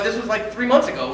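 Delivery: ordinary talk, nothing marked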